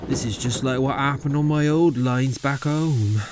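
understated, homesick lament; sighing. A narrator's reading. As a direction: sigh it out with regret. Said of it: "he sighed".

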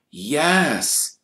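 ordinary delivery, nothing marked

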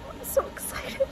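tiny high, airy squeal; excited squeal. Real, not acted. Cuddling the puppy on the drive, hushed excited squeal. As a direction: squeak it out under your breath with glee.

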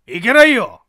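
a loud firm no; loud man's refusal. Acted. Ex-husband refusing to let her go, loud. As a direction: shout the refusal.

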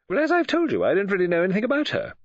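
quick, animated disclaimer; Mrs Oliver, protesting. Acted.

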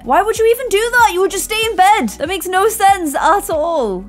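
high, disbelieving scold at a silly husband; high, fast telling-off of the husband; exasperated scolding. Real, not acted. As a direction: scold him in a high incredulous voice.

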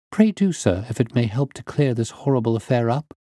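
put-on high-pitched, willing young woman; earnest niece. Niece urging the detective to question her, high and earnest.

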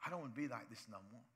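soft, low pleading prayer; quiet prayer. Retold or imitated. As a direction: pray it humbly, hushed. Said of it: "come before God and say".

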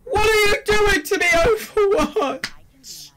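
high, slow whimpering wail at the game; hammed-up sob, stretching out Overwatch; mock crying. Bad loot box opening.

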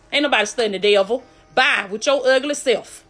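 ordinary delivery

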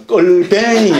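껄뱅이 in broad Gyeongsang dialect, chuckling. Shown as a demonstration. Monk joking to listeners in Gyeongsang dialect, laughing. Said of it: "경상도 말로 뭡니까?".

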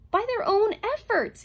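high, loud, agitated insistence; desperate robot protest; insistent. A narrator's reading. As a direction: insist at the top of the voice. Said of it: "insisted Herbie".

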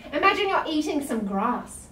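usual speaking voice

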